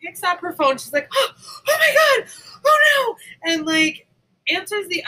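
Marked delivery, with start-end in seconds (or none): 1.1-3.5 very high, loud, wailing oh my God; overacted shriek of alarm; mock panic scream.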